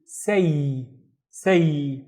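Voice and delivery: slow isolated "say"; syllable demo. Shown as a demonstration. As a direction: SAY closed with an ee glide. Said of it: "have to pronounce the ya in here".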